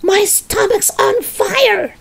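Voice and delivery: high, slow stretched-out yell; alarmed shout. A narrator's reading. Grandma after swallowing the mixture, shouting. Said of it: "she shouted suddenly".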